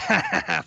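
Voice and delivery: imitated gloating ha-ha-ha; mock laugh. Imitating a smooth talker laughing at his suckers, mock laugh. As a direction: fake a quick, mocking cackle. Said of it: "at the end he goes".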